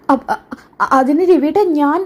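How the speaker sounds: high, stumbling start; flustered stammer. Acted. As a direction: trip over the first syllable, caught out. Scolded wife stammers to husband. (ഭർത്താവിന്റെ ശകാരത്തിൽ പതറി വിക്കുന്നു)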